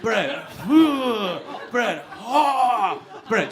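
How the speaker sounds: loud Brett, then a high rising-falling moan; mock orgasm groans after each shout of the name; acted climax cries. Acting out the requested shouting and climax.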